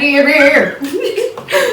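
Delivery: laughing mock old-timer; old-time southerner. Co-host teasing vlogger's accent, mock old-timer. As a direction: put on an eighteen-hundreds voice. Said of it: "talk like you from eighteen hundred".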